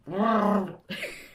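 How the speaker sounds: loud, high imitation Wookiee call; Chewbacca growl. Shown as a demonstration. Co-host proves they can replicate Chewie, Chewbacca growl. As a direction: imitate a Wookiee roar at full volume. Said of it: "I'm sure I can".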